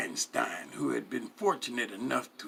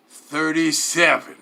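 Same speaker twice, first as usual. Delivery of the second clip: slow, louder announcement of the price; bartender calling total; 4 semitones higher, 11 dB louder, slower than usual. Retold or imitated. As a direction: spell out the tab slowly.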